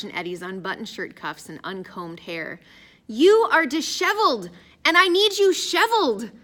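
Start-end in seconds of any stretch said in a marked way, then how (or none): 3.1-6.3 high, loud, exasperated fussing; fussy scolding. A narrator's reading. Addison fussing over Raj and Eddie's clothes, scolding. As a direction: scold them in a raised, emphatic voice.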